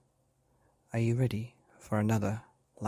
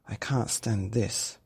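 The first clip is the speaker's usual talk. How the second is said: slow, drawn-out complaint; weary complaint; slower than usual. Acted. Tired Daddy Bear complaining to himself, slow weary voice. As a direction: drag the words, worn out. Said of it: "said Daddy Bear".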